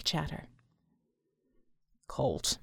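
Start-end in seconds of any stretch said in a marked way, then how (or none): 2.1-2.6 low, soft, unruffled voice; ridiculously calm. A narrator's reading. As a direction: say it deep and utterly composed. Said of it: "sounding ridiculously calm".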